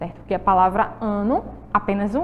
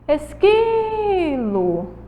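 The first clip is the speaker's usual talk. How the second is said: Esquilo called high and very slow, the qui drawn out; stretched syllable call; 5 semitones higher, much slower than usual. Shown as a demonstration. Teacher calling the word so kids hear the stressed syllable. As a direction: sing out Esquilo, lingering on qui. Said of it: "Chama aí o esquilo".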